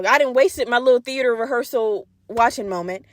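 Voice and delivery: ordinary talk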